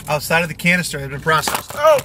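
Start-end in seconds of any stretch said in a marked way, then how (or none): 1.7-2.0 startled. Real, not acted. Camera drops while recording.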